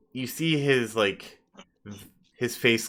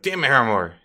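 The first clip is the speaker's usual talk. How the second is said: louder irritated snap; annoyed curse; 3 semitones higher, 7 dB louder, faster than usual. Acted. NPC cursing Harrimore in the doorway. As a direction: curse the servant. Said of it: "It's like".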